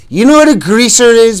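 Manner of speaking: high, loud mocking bully; sneering question. A narrator's reading. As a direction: bait him with the question. Bob insulting them.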